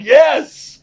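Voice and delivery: a loud, high burst of agreement; excited yell. Retold or imitated. Retelling his own reaction.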